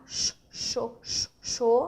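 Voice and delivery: Sh, sho blending the hushed sh into o; sh then o. Shown as a demonstration. Teacher sounding out the start of cachorro, sh sliding into o. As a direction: hush the sh, then open into o. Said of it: "Agora tem o som do O".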